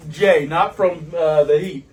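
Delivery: high, loud, slow laughing speech; crying with laughter as you speak; laughing to tears. Real, not acted. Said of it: "These tears are from".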